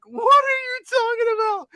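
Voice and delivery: very high, exaggerated squeal of protest; high-pitched disbelief. Storyteller replays his answer to the lady, high-pitched disbelief. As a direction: shoot way up high in mock bafflement. Retells his baffled reply.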